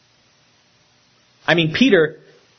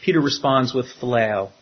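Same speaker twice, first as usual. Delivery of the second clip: usual speaking voice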